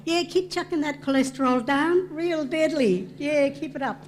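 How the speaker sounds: sarcastically egging them on; mock encouragement. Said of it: "That's like telling our mob".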